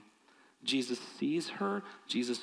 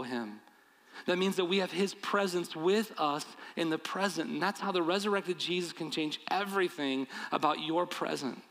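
rousing preaching; 4 semitones higher than usual. Acted. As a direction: build the promise to the congregation with conviction. Preaching at full force.

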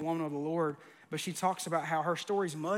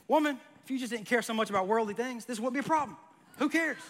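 mock spiritual brush-off; 6 semitones higher than usual. Pastor acting argument with wife.

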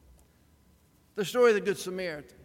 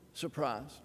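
dropped low and much softer, falling; low dry aside; 8 semitones lower, 10 dB quieter than usual. Acted. Preacher to congregation, a low soft wry punchline. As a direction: let it drop, a wry undercut. Punchline refrain after slow build.

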